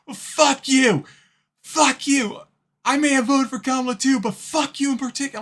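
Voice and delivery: high, loud mocking rant; shrieking a fake angry woman's reply; mock furious voter. Streamer mocking the angry voter, high and loud. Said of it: "It's like".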